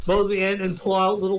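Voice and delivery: ordinary talk, nothing marked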